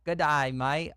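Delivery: hammed-up Australian accent. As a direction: land the mock Aussie accent. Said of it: "Okay, there we go".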